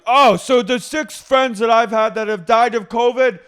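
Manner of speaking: high, very loud acted rant; mock outraged yell. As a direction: shout it like an indignant housewife in an argument. Acted quote from reality show argument.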